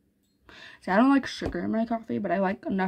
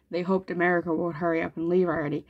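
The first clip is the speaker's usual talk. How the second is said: ordinary delivery, nothing marked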